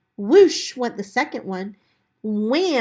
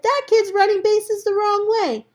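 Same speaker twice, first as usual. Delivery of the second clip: high, louder mocking boy's voice; jeering kid; 11 semitones higher, 6 dB louder than usual. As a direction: point and laugh at him. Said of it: "Jimmy said".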